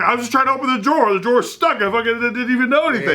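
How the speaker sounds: retold yelling. Husband blaming the stuck drawer, mild mock yell. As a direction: mimic a guy yelling at a stuck drawer, lightly. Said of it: "like, yelling".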